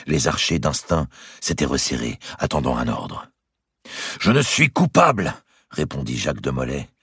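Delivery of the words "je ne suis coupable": high, forceful declaration; impassioned defiance. A narrator's reading. Grand master answers his accuser, impassioned defiance. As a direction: proclaim it to the whole square.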